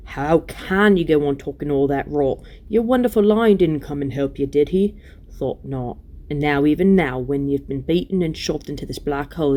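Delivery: jeering, disbelieving dwarf rant; surly dwarf Diggle, scornful. Acted. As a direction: sneer at the lies as a dwarf.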